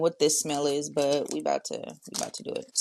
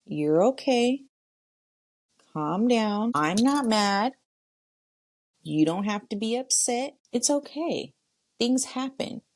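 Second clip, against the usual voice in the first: higher-pitched, soothing pep talk; reassuring a child; 6 semitones higher than usual. Acted. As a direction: reassure your child self in a lifted voice. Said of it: "How do you need to be spoken to".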